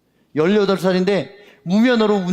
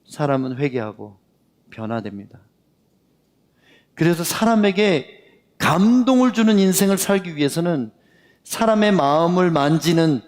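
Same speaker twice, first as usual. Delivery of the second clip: usual speaking voice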